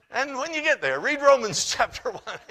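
loud, high, breaking into laughter; laughing exasperation. Retold or imitated. Preacher retelling his plea to the woman, laughing exasperation. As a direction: call out the parting order, laughing.

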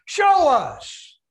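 loud, high jeering taunt; a thief's loud scornful heckle; mocking taunt. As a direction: jeer at him from the cross. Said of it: "taunting Jesus Christ and mocking him".

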